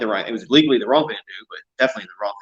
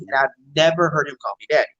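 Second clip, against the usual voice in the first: ordinary talk, nothing marked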